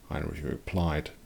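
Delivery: plain reading voice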